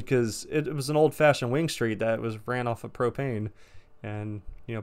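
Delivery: ordinary talk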